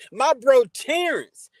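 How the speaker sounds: loud, slow call-out of a friend's name; excited greeting. Real, not acted. Greeting his bro Terrence in chat, loud and drawn out. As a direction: hype up the arrival, drawn out.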